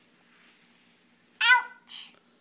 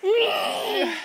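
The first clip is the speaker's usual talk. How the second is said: monster yowl; 3 semitones higher, 12 dB louder than usual. Pretend. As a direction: roar like a monster cat. Child as kitty monster.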